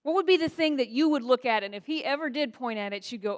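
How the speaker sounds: ordinary delivery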